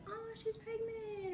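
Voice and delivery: high, soft, drawn-out coo; imitating cooing strangers. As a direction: gush softly and slowly.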